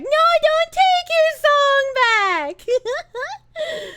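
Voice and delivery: high, stretched-out mock wail; mock pleading. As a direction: beg in a squealing, drawn-out voice.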